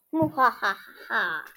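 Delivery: evil villain laugh. Acted. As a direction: cackle like a cartoon villain.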